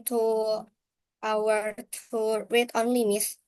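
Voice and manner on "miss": normal talking voice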